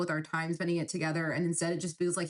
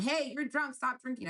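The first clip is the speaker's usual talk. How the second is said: mock yell; 7 semitones higher than usual. Shown as a demonstration. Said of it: "It's not like I yelled".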